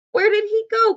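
high-pitched urgent question; excited storybook cry. A narrator's reading. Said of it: "cried Bridget".

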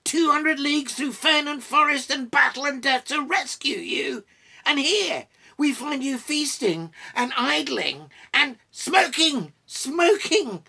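loud, high-pitched ranting tirade; indignant outburst. A narrator's reading. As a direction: scold the rascals at full voice. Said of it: "cried Gimli, unable to contain himself".

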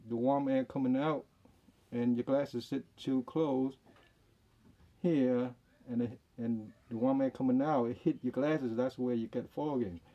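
muffled behind mask; speaking through mask fabric; through a face mask. Real, not acted. Seen 1: Blue surgical mask covers nose and mouth throughout; reference frames unmasked.

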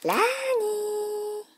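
long, high, sung-out name; name call. Acted. Host announcing the toy bus by name, sing-song call. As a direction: call the character like a cartoon intro.

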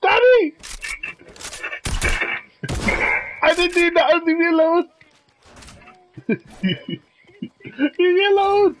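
put-on high, bratty kid voice; whiny child voice. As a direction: whine like a spoiled kid.

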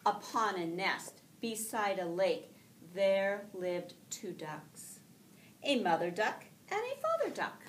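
lively storybook read-aloud with voiced ducks; reading to children. Teacher reading a picture book aloud to her class, lively and gentle.

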